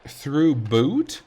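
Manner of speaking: "through boot" with a hard b, said as two separate words; misheard through boot. Shown as a demonstration. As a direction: split it into "through boot", hitting the b. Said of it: "think that I'm saying".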